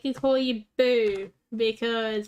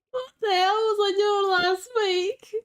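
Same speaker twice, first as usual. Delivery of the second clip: high, strained laughing outburst; half-crying laugh at himself; laugh-cry in disbelief; 9 semitones higher than usual. Real, not acted. Laughing at own mistake.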